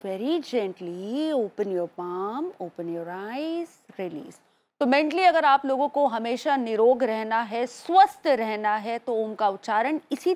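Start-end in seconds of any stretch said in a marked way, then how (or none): 0.1-4.4 guided meditation. Acted. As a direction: ease them gently out of the chant.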